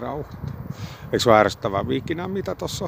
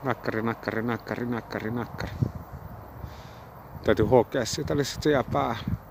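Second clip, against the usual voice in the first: talking around a cigarette; cigarette in mouth; 9 dB quieter than usual. Real, not acted. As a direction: lips gripping a cigarette. Seen 3: Lit cigarette held between lips in every frame while speaking.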